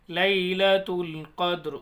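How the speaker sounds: لیلۃ القدر slowly with a bounced final د; qalqalah demo. Shown as a demonstration. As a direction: show the echo on د of القدر. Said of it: "کیسے؟".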